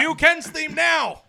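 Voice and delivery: loud, slow call for music; loud announcement. Acted. Calling for theme music.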